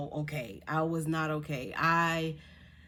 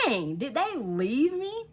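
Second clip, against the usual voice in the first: slow, higher mock dismay; dismayed disbelief; 7 semitones higher, 4 dB louder, slower than usual. Looking around for her missing family, dismayed. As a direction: draw it out, pitched up in disbelief.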